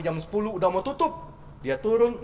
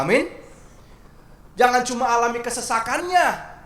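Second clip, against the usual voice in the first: loud, rising sermon push; fervent preaching; 4 semitones higher, 6 dB louder than usual. Acted. Pastor urging the congregation, loud fervent preaching. Preacher building to full force.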